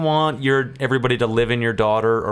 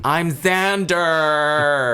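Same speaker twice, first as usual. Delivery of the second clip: mock introduction; 5 semitones higher, much slower than usual. Posing as a kid named Xander.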